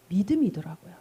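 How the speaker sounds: whispered low. Real, not acted. Preacher drawing listeners close in a whisper.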